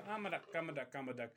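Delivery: lightly voiced silly duck, soft and low. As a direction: murmur it like a dumb duck paddling. Voicing the duck again.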